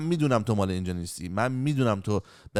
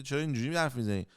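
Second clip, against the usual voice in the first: usual speaking voice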